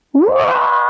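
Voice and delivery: loud high shouted roar; big lion roar. Shown as a demonstration. Teacher's second try at a lion roar, loud. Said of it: "Let's try it again".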